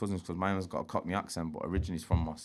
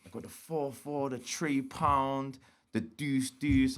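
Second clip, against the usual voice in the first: slow, drawn-out list, pitched higher; reeling off a list; 5 semitones higher, much slower than usual. Retold or imitated. Seller listing guns to a buyer, reeling them off slowly. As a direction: spell out each item slowly, higher-voiced. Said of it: "reels off some shopping list".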